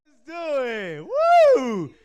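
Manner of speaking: normal talking voice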